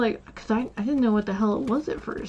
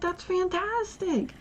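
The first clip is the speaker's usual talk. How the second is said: sarcastic uncle; 10 semitones higher, 4 dB quieter than usual. Retold or imitated. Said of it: "and he was like".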